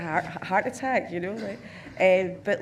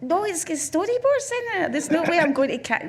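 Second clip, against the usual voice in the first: very high, loud incredulous refusal; hammed-up exasperated objection; retold protest; 10 semitones higher, 5 dB louder than usual. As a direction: act out a squeaky, indignant no. Acts own protest to financier.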